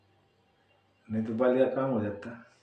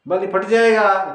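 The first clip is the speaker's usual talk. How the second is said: बाली फट जाएगा called out higher and louder; emphatic exclamation; 7 semitones higher, 10 dB louder than usual. Shown as a demonstration. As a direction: throw it out to the crowd in alarm. Same words repeated louder.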